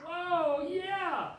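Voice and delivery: loud, high excited cheer; excited whoa. Real, not acted. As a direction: whoop it up for the crowd. Host hyping excited audience.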